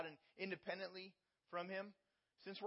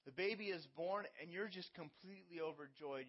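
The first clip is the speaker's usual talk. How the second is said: usual speaking voice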